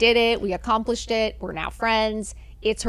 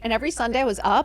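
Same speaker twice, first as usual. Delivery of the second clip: normal talking voice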